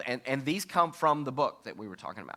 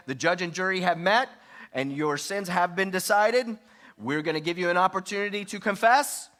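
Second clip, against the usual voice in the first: higher, louder mock prosecutor tone; accusing, criticizing; 5 semitones higher, 4 dB louder than usual. Shown as a demonstration. Spouse criticizing partner like a judge, mock accusing and loud. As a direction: lay down the verdict like a judge. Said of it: "you don't say".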